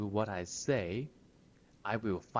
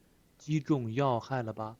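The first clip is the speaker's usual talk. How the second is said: cocky line; 4 semitones higher, 5 dB louder than usual. Retold or imitated. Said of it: "Very cocky, right?".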